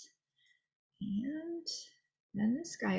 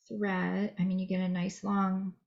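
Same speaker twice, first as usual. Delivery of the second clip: normal talking voice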